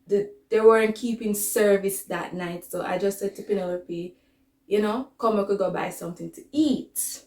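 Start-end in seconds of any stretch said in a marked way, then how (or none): none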